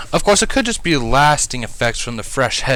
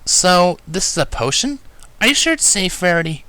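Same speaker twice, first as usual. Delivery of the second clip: lightly voiced, higher curious young mare; a doubtful scholar checking on safety; Twilight; 8 semitones higher than usual. A narrator's reading. Twilight's voice in the story.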